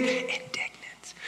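hushed, whispered "indignant". Shown as a demonstration. Showing how not to be indignant.

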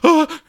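sudden startled yelp; gasp of shock. Retold or imitated. Describing the toilet seat horror, startled gasp. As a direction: gasp then a high, loud ah. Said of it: "you're like".

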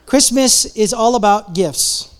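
"Christmas is all about gifts" spelt out slowly, each word weighed; slow down and let it land; slow and deliberate. Real, not acted. Repeats the line, slowed.

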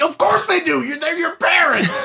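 high-pitched exasperated retort; incredulous, pitched well up; mock exasperation. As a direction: protest it as painfully obvious. Mock-exasperated retort to movie line.

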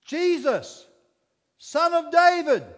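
high, loud desperate call; yelled. Acted. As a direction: cry out to be heard across a crowd. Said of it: "being yelled at him".

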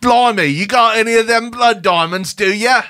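a bad try at the South African accent; attempted South African accent. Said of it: "No, that's not it".

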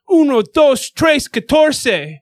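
loud, high-pitched yelled count-in; shouted rock count-off, drawn out. Retold or imitated. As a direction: yell it out to kick off a song. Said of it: "[name] yell-, he starts out a song".

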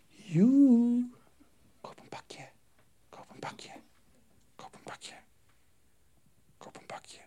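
faint whispered temptation; sly inner voice whispering; tempting whisper. Acted. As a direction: whisper it like a craving in the ear. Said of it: "Stemmetje klinkt zo".

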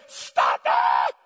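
harsh, sudden mock scream; imitated mother's yell. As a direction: yell it like a mother stopping rough play. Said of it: "she yells your name".

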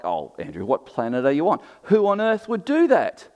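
sarcastic mock disbelief, jumping high and loud on the last question. Preacher voicing his sceptical congregation back at himself, sarcastic. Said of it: "Hear how I'm being sarcastic?".

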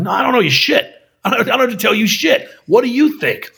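high-pitched, quick mock indignation; defiant retort. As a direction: push back hard at a demanding fan. Acted retort to demanding viewers.

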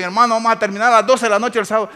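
loud, fast call to the vigil; eager announcement. Retold or imitated. Church brother inviting to a vigil, loud and fast. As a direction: announce it with gusto.

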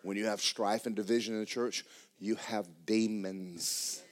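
ordinary talk, nothing marked

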